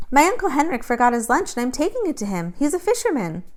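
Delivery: put-on a girl covering her errand, pitched high; young girl's voice. A narrator's reading.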